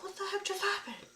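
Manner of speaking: high, stunned reenacted gasp of a question; shocked disbelief. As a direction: replay the moment it hit you, bewildered. Retelling her shocked reaction.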